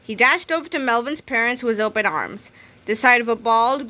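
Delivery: usual speaking voice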